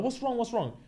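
put-on sweetly worried girlfriends; concerned friends. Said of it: "They're like".

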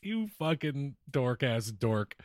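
ordinary talk

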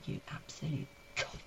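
whispered, voiceless swearing; retold hushed insult. Comic insulting the punter to his face, whispered. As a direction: mouth the insult almost without sound. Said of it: "like this".

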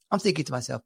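ordinary talk

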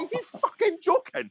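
very high, laughing disbelief; outraged disbelief. Retold or imitated. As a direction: squeal it in outrage. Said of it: "I was like".

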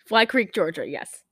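usual speaking voice

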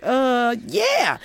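a startled yeah jumping way up high; gulp, then squeak out the yes; stunned yes. Retold or imitated. Eighteen-year-old asked to travel with Prince, squeaked high yeah.

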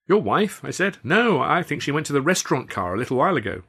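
overly bright innocent denial; feigned innocence. A narrator's reading. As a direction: play dumb to the husband. Guilty lover feigning innocence.

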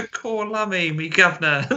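mock Cockney, laughing through it; hammy London cockney voice; Cockney accent. Mock Cockney after accents talk.

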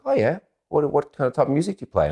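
high, put-on girl's voice; imitated interested girl. Girl asking a would-be musician about his band, high imitation. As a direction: ask with interest, pitched well up.

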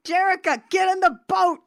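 high, urgent storybook call. A narrator's reading. Rio calls to swimmers from the dinghy, high urgent call. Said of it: "you hear Rio's voice call out".

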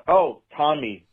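ordinary talk

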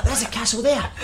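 high mock little-woman voice; high-pitched teasing imitation; imitating a wee woman. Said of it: "Remember the wee woman?".

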